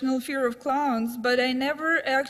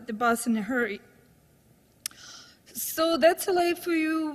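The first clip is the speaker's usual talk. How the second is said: ordinary talk, nothing marked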